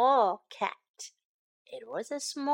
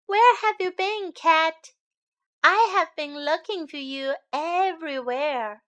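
put-on high, eager child's voice; a boy relieved to find his cat; higher and a little louder, glad; 5 semitones higher, 5 dB louder than usual. Boy greets found cat.

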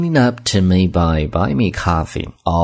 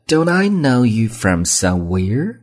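Don't I know you from somewhere with a slow climb up at the end; rising question tone; 5 semitones higher, slower than usual. Shown as a demonstration. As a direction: ask it, letting the pitch rise at the end. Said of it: "一般疑问句，用声调". (一般疑问句，句尾用升调)

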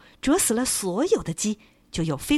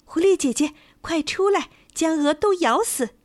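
calling the fox; 8 semitones higher, 4 dB louder than usual. A narrator's reading. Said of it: "公鸡对狐狸说".

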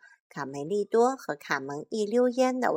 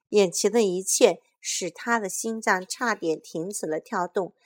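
ordinary delivery, nothing marked

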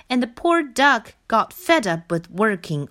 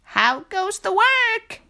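higher mocking call; farmer calling; 11 semitones higher than usual. A narrator's reading. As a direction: call it out sing-song. Farmer calls out.

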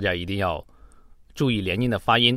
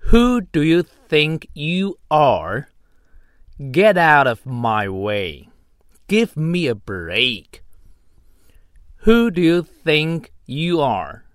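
slow angry demo read; 3 semitones higher, slower than usual. Shown as a demonstration.

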